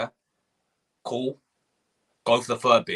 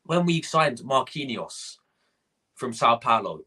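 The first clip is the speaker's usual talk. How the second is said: normal talking voice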